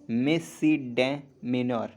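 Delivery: "मिसिडेमेनर" drawn out very slowly, syllable by syllable; slow careful demo. Shown as a demonstration. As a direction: spell it out by ear. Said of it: "next".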